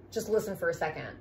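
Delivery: usual speaking voice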